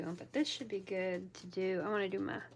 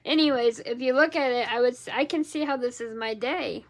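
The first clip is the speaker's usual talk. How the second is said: loud, raised voice; hollering; 3 semitones higher, 11 dB louder than usual. Real, not acted. Crafter with clogged ears explaining to viewers, hollering. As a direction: keep hollering as you explain. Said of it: "Yeah, I'm hollering".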